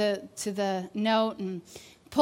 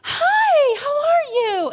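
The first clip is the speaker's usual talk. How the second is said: much higher, loud, squealing hello; bubbly greeting; 10 dB louder, slower than usual. Retold or imitated. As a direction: mimic an overjoyed friend calling out hi. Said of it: "this bubbly, life-filled person".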